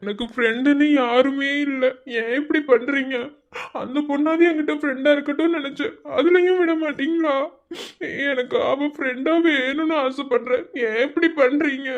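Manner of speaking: a nervous junior begging the senior; pleading timid student. Acted. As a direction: plead meekly to get the letter back. Timid student laments, begs.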